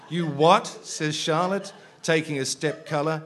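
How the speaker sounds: steady, measured reading of a novel; story reading. A narrator's reading. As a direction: read it like an author at a bookshop. Author reads novel aloud.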